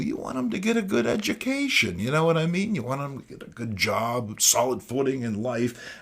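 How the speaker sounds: ordinary talk, nothing marked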